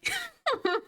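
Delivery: squeaky burst of laughter; high giggle. Real, not acted. Laughing at own bit.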